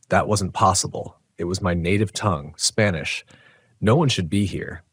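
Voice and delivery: ordinary delivery, nothing marked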